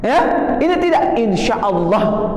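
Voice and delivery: usual speaking voice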